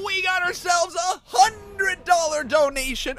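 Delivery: high, loud, thrilled shouts of disbelief; ecstatic shouting. Real, not acted. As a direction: scream with joy at the donation. Reacting to hundred dollar donation.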